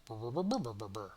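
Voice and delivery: imitated humming buzz. Voicing the humming heart orb.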